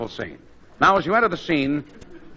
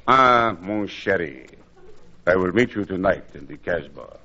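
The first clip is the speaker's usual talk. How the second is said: put-on French lover accent; 10 semitones lower than usual. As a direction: purr it like a Casbah romancer. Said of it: "Listen to this, Gracie".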